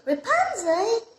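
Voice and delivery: high, loud startled "Rapunzel?" rising; surprised question. Acted. Prince suddenly sees Rapunzel, calling her name in loud surprise. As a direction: ask in sudden disbelief. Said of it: "the prince said like this".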